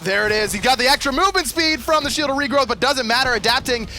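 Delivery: high, driving hype commentary; excited play-by-play. Real, not acted. Caster calling Fire Giant secured to viewers, excited. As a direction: push the call to the objective's climax. Live esports play-by-play at peak.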